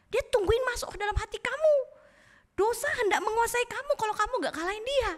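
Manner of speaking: put-on high-pitched Batak-accented scolding. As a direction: play God as a Batak speaker warning someone. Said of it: "Ini kalau Tuhannya Batak".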